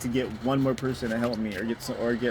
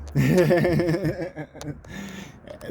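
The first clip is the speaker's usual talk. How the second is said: long, higher-pitched chuckle trailing away; self-deprecating laugh; 6 semitones higher than usual. Real, not acted. Vlogger apologizing to viewers for wasting time, self-deprecating laugh. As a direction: laugh at your own apology.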